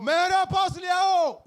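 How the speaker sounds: slow, loud, high command; Jesus ordering the crowd, slow loud command; commanding shout. Acted. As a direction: call out the order to bring him. Jesus commanding bring him.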